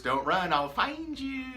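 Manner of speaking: creepy quote with a high leap on find you; Wedding Crashers quote. Retold or imitated. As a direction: play the clingy stalker from the movie. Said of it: "you guys ever seen, uh, Wedding Crashers?".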